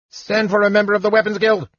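high, fast gnome chatter. Acted. Gnome calls for the Weapons Guild in rushed high chatter. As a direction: rattle it off in one breath like an eager gnome.

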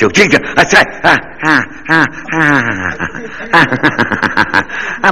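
high comic groans of pleasure; mock moaning. Comic groaning as the monkey chews on him.

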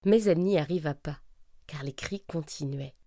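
plain reading voice

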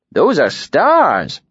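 a cheerful, lively tinker. A narrator's reading.

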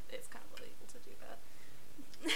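conspiratorial whisper; hushed aside. Real, not acted. Joking aside about illegality.

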